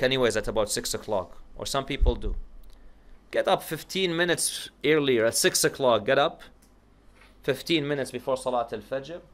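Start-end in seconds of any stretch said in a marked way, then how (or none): none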